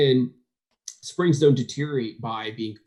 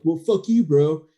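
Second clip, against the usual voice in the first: louder, higher, indignant snap; mock upset retort; 4 semitones higher, 6 dB louder than usual. Mocking a touchy pro who got corrected, higher and louder. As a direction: throw it back like a touchy pro. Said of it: "the ones that get upset".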